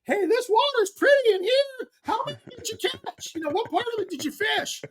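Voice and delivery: very high-pitched mocking impression; Roland Martin. Rival angler calling to him as he idles by, high mock voice. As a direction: squeal it up high, easing down by the last question. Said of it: "and Roland goes".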